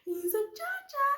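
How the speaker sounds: high playful auntie voice; playful stranger voice. Retold or imitated. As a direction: tease in a high lilting voice. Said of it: "She's like".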